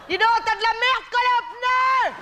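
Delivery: high, slow jeering call; mock shout. Jeering at the slow car in front with a high mock shout. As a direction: yell it out the window, hamming it.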